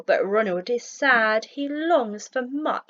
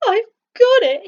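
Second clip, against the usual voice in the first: high, loud burst of delight; joyful eureka, much higher and louder; excited cry; 12 semitones higher, 10 dB louder than usual. A narrator's reading. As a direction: shout out the sudden idea. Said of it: "he cries with a smile".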